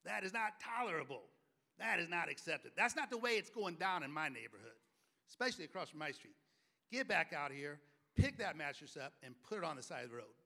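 put-on stern father shouting at a boy across the street; stern neighborhood dad. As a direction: lay down the law to the kid. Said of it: "he goes".

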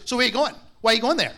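loud, high pushy questioning; loud demand. Retold or imitated. Husband loudly grilling his wife, pushy. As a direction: fire the questions at her. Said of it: "it comes out like this".

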